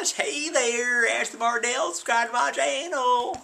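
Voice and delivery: high, slow, sing-song fangirl voice; high, slow mock-fan gush; mock subscriber voice. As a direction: squeal it like a gushing fan. Mimicking the subscriber.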